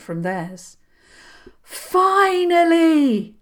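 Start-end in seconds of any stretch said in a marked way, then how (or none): 1.6-3.4 high, slow, loud cry to the sky; shouting at the sky. Acted. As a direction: fling it up at the heavens. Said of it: "she shouted at the sky".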